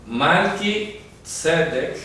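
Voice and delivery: Melquisedec very slowly, split as malki, TSE-dek; slow Hebrew Melquisedec with stress on TSE; careful transliteration. Shown as a demonstration. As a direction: spell out Melquisedec by sound, stretched in two parts. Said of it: "la transliteración correcta".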